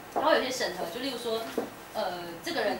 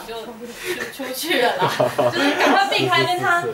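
loud, higher, laughing it out; 3 semitones higher, 9 dB louder, slower than usual. Real, not acted. Telling how she slipped out early, laughing. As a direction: laugh at your own escape. Laughs recalling escaping the host.

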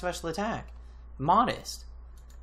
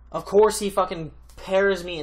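louder, higher, ranting; angry complaint; 5 semitones higher, 7 dB louder than usual. Real, not acted. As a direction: vent at the unfair luck.

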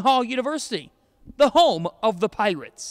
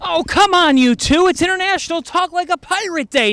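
high, louder mock exasperation; exasperated; 8 semitones higher, 4 dB louder than usual. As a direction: plead with the silent pair. Host exasperated at silent students.

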